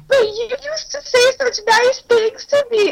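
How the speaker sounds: high, pleading mock whine; whiny high complaint. Mocking her own complaint to the ex, high and whiny. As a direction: whine it like a hurt girlfriend. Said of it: "I was like".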